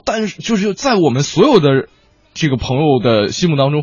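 usual speaking voice

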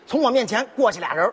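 usual speaking voice

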